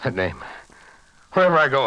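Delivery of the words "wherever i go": higher and louder, desperate; anguished outburst. Acted. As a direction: burst out in torment. Tormented man cries out.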